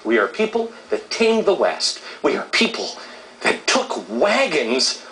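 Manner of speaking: high-pitched patriotic rallying speech; proud, rousing declaration to the nation; fired-up public speaker; impassioned oratory. Real, not acted.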